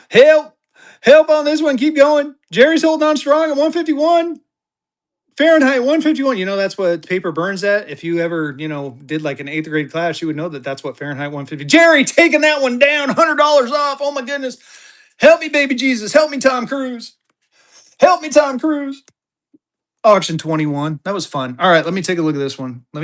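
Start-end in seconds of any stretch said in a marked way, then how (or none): 0.1-6.4 high-pitched auction hype; excited bid call. Real, not acted. Auctioneer calling out for more bids. 11.6-19.0 high-pitched auctioneer excitement; excited hype. Real, not acted. Auctioneer hypes a bargain, mock pleading.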